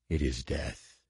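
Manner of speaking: low, soft final threat; creeping nightmare whisper. Acted. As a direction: breathe it out, smiling. Creature pauses, smiles, says it.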